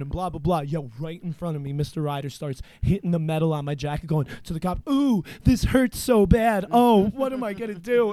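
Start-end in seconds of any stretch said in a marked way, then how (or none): 4.9-8.1 mock pain. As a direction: ham up a pretend "ouch" to mock the cop. Principal mocks pain to the cop.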